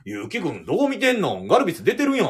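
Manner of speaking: a hint of the manager mocking the reporter; Kansai manager teasing. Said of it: "藤本さんそれ聞いて". (監督が記者に関西弁でツッコむ)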